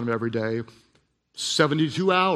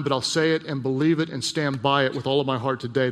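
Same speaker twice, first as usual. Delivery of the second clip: ordinary delivery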